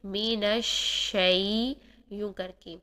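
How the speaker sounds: الشی with a soft spread sh, slow; الشی, soft spread sheen. Shown as a demonstration. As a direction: ease into the ش gently. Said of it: "نرمی سے touch کیجئے".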